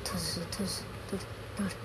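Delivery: soft, low pretend gun noises; mock gunshots. Player fires the pretend gun at the intruder, mock gunshots. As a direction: make quiet little laser-shot sounds with the mouth.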